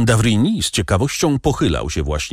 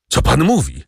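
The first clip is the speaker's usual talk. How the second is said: exclaimed; 5 semitones higher than usual. A narrator's reading. Father shocked by the doctor's words, crying out. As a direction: cry out in shock. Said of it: "zawołał".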